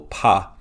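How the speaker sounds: "pa" said loud as a lone stressed syllable; emphasized syllable. Shown as a demonstration. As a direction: punch out "pa" on its own. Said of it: "emphasize that first syllable".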